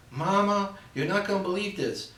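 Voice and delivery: begging her mama for rescue; pleading daughter. Acted. Bride pleading with her mother, high and whiny.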